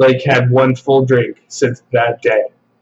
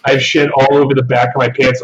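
ordinary delivery

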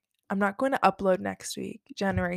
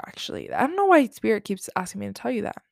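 normal talking voice

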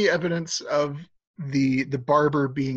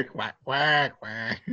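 spoken quack quack quack; duck quacks; much slower than usual. Pretend. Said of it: "Why are you sounding like a duck?".

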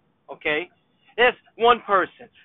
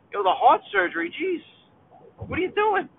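incredulous; 8 semitones higher than usual. Acted. Mocking the scooter gambler.

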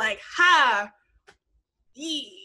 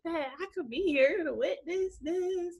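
high, slow mock-tearful gushing; imitated happy crying; 6 semitones higher, 4 dB quieter, slower than usual. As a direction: gush slowly like a mom crying for joy. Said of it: "like, she was crying".

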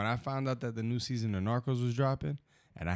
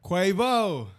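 hyped shout; 11 semitones higher, 9 dB louder than usual. Real, not acted. Hyped name reveal.

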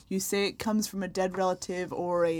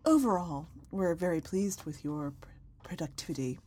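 disappointed mom voice; 2 semitones lower than usual. Acted. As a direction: sigh it out like a let-down mom. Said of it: "I can do the disappointed mom voice".

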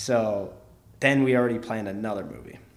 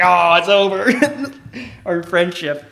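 high, loud, slow wail broken by laughter; mock-dramatic doom; 8 semitones higher, 14 dB louder, much slower than usual. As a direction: ham up the tragedy while laughing. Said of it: "I'm like".

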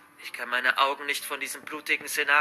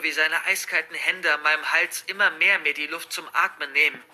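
usual speaking voice

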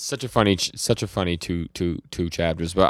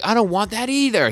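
high, loud imitated whine of refusal; mock crying protest; 6 dB louder than usual. Denji answering Yoshida's threat to Nayota, mock tearful protest. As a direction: protest as if starting to cry, playfully overdone. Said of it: "He starts crying, kinda".

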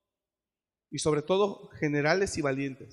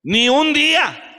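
slow, high, loud shout; emphatic shout; 10 semitones higher, 12 dB louder, slower than usual. Real, not acted. Preacher insisting God allowed no mourning, shouting slowly. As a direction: hammer each word home. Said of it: "Dios no le permitió".

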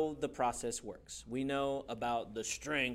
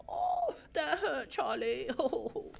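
much higher, slow squeaky whine; mock pained squeak; 11 semitones higher, much slower than usual. Pinched finger from magnets, high squeaky mock whine. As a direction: whine it way up high like a cartoon victim.

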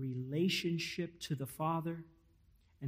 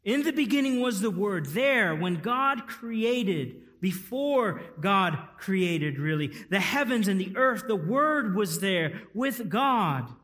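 loud, high, slow preaching; preaching at full force; 5 semitones higher, 9 dB louder than usual. Acted. Pastor proclaiming to the congregation at full force. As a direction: proclaim it to the back row, slowly.

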